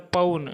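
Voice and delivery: पौन said 'pa-un', with a clear glide; औ का उच्चारण. Shown as a demonstration. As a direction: open औ in पौन into a plus u. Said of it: "यहाँ औ बोले". (पौन को 'पउन' जैसा बोलें)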